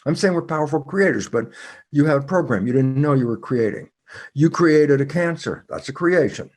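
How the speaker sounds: normal talking voice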